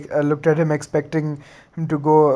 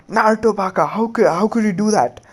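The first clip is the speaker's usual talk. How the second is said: higher, angry scolding cry; shouting at Naruto; 5 semitones higher than usual. Acted. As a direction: scold him with an angry high yell. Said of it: "Sakura shouted at him".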